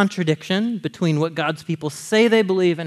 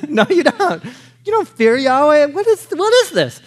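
mock incredulous outburst; 9 semitones higher, 5 dB louder than usual. Mocking the reader's disbelief, laughing.

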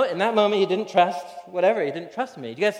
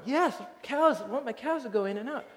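put-on higher, insistent little kid; toddler voice; 7 semitones higher, 4 dB quieter than usual. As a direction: lift the voice like a small boy who knows best. Toddler insists on his cows.

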